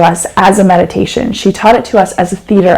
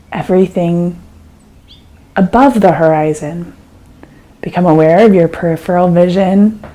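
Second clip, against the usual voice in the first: calm, unhurried meditation guide with long pauses; guided meditation. Acted. Teacher guiding a listener through an eyes-open meditation, calm and unhurried.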